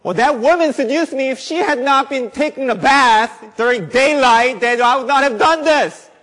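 high, loud whiny sinner blaming the woman; mock excuse-maker. Preacher mocking a sinner who blames the woman, high and loud. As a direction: ham up a guilty man dodging blame, laughing through it. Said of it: "He doesn't say".

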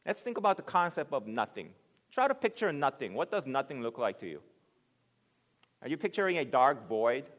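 ordinary delivery

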